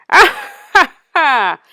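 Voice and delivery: wicked wizard laughing, then a slow threat. A narrator's reading. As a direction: gloat at the trapped princess. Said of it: "he laughed his horrible laugh".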